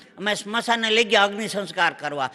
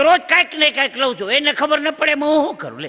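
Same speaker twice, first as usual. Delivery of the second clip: high, loud wife answering back; hammed-up indignation, pitched well up; mock indignant retort; 6 semitones higher, 4 dB louder than usual. Wife retorts to husband's scolding. (ઊંચા અવાજે સામો જવાબ આપતી પત્ની)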